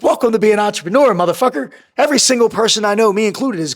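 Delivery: high-energy acted-out retort; retold emphatic reassurance. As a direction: brush off the confession like a forceful boss. Boss brushing off his bankruptcy confession.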